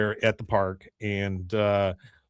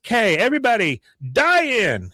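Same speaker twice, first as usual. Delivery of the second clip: high-pitched mock girl organizer; imitated high-voiced protest leader; rallying niece; 10 semitones higher, 4 dB louder than usual. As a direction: call the troops up high. Luann directs the protesters.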